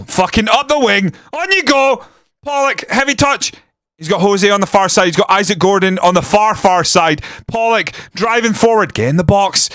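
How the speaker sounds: high, urgent live commentary; excited play-by-play. Real, not acted. Fan urging his team forward on the counter, rising excitement. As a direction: call the attack as it unfolds.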